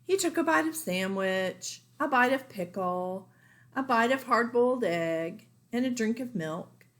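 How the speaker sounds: plain reading voice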